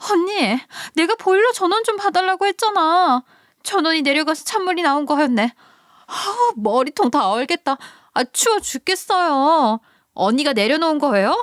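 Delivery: high, shrill-edged complaint about the cold; sister-in-law confronting the wife about the boiler, high and accusing. Acted. Sister-in-law finds boiler switched off.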